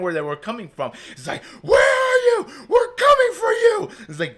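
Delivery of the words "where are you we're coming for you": high, loud distorted calling voices; eerie voices. Retold or imitated. Imitating the voices on the mountain, a high loud eerie shout. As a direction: shout it up high like a ghostly pursuer.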